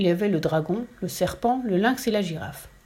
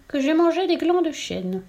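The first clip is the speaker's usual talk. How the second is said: embarrassed piglet; 8 semitones higher than usual. A narrator's reading. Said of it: "en rougissant".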